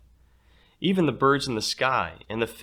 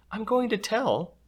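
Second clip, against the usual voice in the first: put-on tattling girl; 13 semitones higher than usual. Rita threatens to tell.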